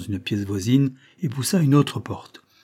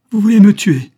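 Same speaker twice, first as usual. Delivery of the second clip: high, loud cry of alarm; 11 semitones higher, 14 dB louder than usual. A narrator's reading. Woman realising her lover means to kill her, crying out. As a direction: cry out in horrified disbelief. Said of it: "s'écria-t-elle".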